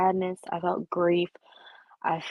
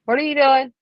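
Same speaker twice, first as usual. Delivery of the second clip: sudden loud "what are you doing"; loud call-out; 5 semitones higher, 9 dB louder than usual. Real, not acted. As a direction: call out sharply, startled. Sudden call-out at someone off camera.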